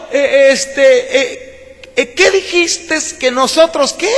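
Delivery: high, puzzled mock-innocent whine, pitched well up; lightly hammed-up voice of a clueless crowd; voicing the complaining people. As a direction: play the people shrugging off God's charge. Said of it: "así habla el pueblo".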